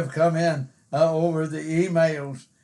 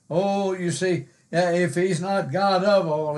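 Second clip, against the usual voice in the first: ordinary talk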